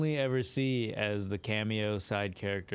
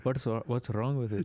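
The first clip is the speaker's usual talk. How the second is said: put-on a thick, heavy Indian accent on the words. Pushed by his cohost to try it, a heavy Indian accent. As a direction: lay the Indian accent on heavy, as if reluctantly giving in. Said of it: "Damn, so you go that heavy".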